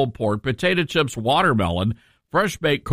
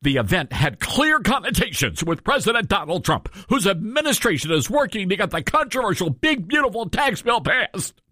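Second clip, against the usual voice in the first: mocking read-out; 6 semitones higher than usual. Shown as a demonstration. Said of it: "I gotta read this to you".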